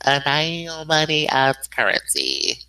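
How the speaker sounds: drawn-out, slow co-host name; said slowly. Shown as a demonstration. Introducing the co-host after "say it slowly", slowly. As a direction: stretch out each word slowly. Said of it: "Say it slowly".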